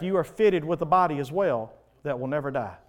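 normal talking voice

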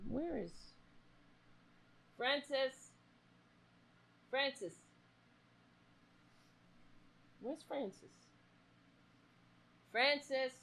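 high, soft sing-song call to a pet cat. Real, not acted. Calling for the cat Francis.

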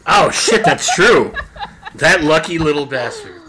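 loud, laughing burst of delight. Real, not acted. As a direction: crack up and shout it out with glee. Delighted at baby's parade birthday.

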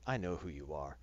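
low, quiet tough-woman menace; understated badass threat. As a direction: drop low and soft, act unimpressed. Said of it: "she has to be badass and say".

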